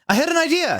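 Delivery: very high, excited outburst; hammed-up eureka cry; exclaimed. A narrator's reading. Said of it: "he exclaimed".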